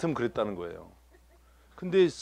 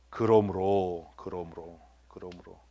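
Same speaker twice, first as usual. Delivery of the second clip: slow first, then softer like an echo; drawn-out, fading repetition; 9 semitones lower, 5 dB quieter, slower than usual. Shown as a demonstration. Preacher dwelling on the verse's key word, slow and fading. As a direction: stretch it low, then let each repeat fade.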